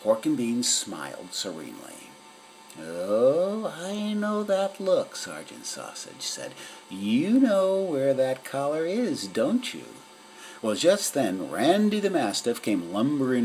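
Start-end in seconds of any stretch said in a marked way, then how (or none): 2.8-5.2 higher, drawn-out teasing voice; knowing cat sidekick. A narrator's reading. 6.9-9.9 higher, drawn-out teasing voice; knowing cat sidekick. A narrator's reading.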